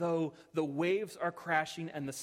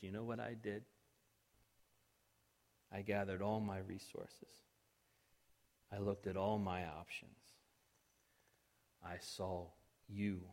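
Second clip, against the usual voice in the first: tender voice of God; 10 semitones lower, 6 dB quieter than usual. Acted. God answering his accusers with quiet love. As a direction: lay it down gently like a loving father. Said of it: "he looks at us and he says".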